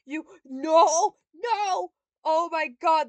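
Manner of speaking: loud high cry of dismay; shout. Real, not acted. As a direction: yell at the game, panicked. Game setback shout.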